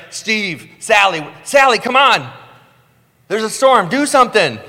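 high, loud panicked shout; panicked wake-up call. Retold or imitated. Scared disciple yelling to wake a friend, high and loud. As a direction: shake him awake in terror.

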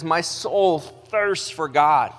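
drawn out, heartfelt; slow earnest reading. Acted. Pastor reads the psalm's longing, slow and heartfelt. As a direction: linger on each word with longing.

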